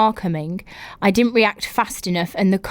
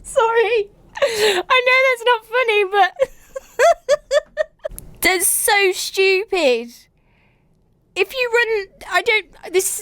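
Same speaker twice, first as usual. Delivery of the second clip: higher, words squeezed through laughter; laughing helplessly; 13 semitones higher than usual. Real, not acted. Host apologising while still laughing at the story. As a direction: try to speak but keep cracking up.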